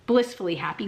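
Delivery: ordinary talk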